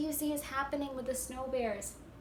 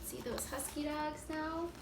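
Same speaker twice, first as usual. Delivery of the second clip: ordinary delivery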